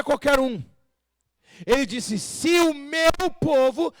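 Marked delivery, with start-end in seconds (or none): 2.2-3.9 slow, loud, high declamation; ringing, drawn-out preacher's call; scripture proclamation. Acted. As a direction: proclaim it forcefully, stretching each word.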